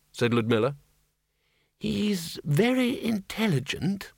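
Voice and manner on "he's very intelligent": put-on old Windle. A narrator's reading. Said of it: "said Windle".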